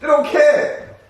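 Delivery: cracking up mid-quote; laughing retort to friends; laughing reply. Retold or imitated. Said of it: "I'm like".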